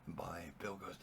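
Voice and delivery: ordinary delivery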